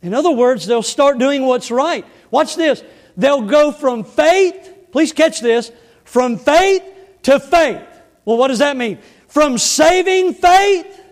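loud, high-pitched pulpit delivery; full-force preaching. Acted. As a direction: preach it out to the whole church, each phrase driven hard.

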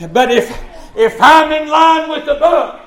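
high, loud pulpit declaration; forceful preaching. Real, not acted. Preacher standing in line with the book, forceful preaching. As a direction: hammer it out to the congregation.

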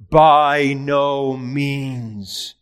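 By no means said very slowly, each word weighted; emphatic denial. Real, not acted. Preacher answering the congregation with a very slow, weighty By no means. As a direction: lean hard on every word of By no means. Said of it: "an emphatic no".

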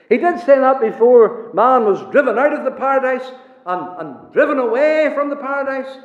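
high, loud pulpit proclamation; full-force preaching. Acted. Preacher proclaiming to the congregation, loud and high. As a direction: preach it out to the whole hall.

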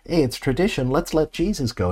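Pilate. Acted. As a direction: pitch it up, like floating a handy way out. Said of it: "He brings this up".

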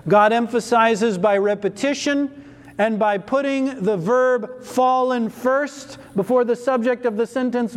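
normal talking voice

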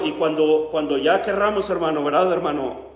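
ordinary delivery

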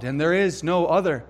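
ordinary delivery

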